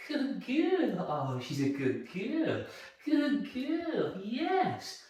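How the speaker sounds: drawn-out, hushed sing-song praise; dog praise. Shown as a demonstration. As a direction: coo lovingly at a dog.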